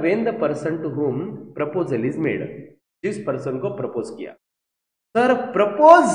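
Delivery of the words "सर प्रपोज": loud, high teasing student voice; mock student tease. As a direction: tease sir like a cheeky student. Student teasing about proposal. (छात्र की तरह चिढ़ाते हुए ज़ोर से)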